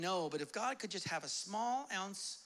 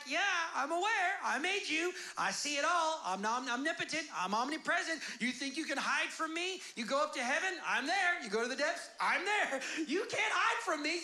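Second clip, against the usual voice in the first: lightly voiced God, raised and bold; a preacher playing God with swagger; voicing God; 6 semitones higher than usual. Preacher voices God to his congregation, bold and rising.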